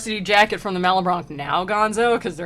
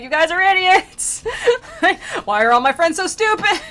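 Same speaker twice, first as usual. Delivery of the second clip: mock exasperated wail; 11 semitones higher, 8 dB louder than usual. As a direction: lament it like a cartoon demon, laughing. Acted demons insulting friends.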